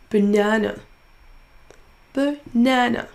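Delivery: NA in the middle of "Banana" stressed; stressed syllable drawn out. Shown as a demonstration. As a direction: lean on the second syllable of "Banana". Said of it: "en prononçant plus fort la syllabe". (deuxième syllabe de « Banana » appuyée)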